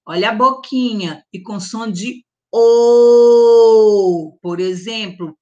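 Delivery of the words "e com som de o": slow, loud closed O in "com som de O", lips rounded; closed O sound. Shown as a demonstration. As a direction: stretch "O" as a closed O with a small round mouth. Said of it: "o O também tem dois sons".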